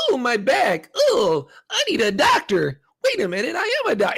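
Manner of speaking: high squeaky cartoon critter; overacted cartoon creature cries; cartoony Chopper voice. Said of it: "it's very, like, performance-esque".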